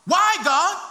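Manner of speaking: loud, high cry of protest; crying out to God. Acted. Blood of the slain questioning God, crying out. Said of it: "when the blood cries".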